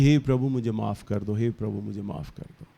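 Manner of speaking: low, softening prayer for forgiveness; retold hushed plea. Retelling a boy asking God for forgiveness, hushed pleading.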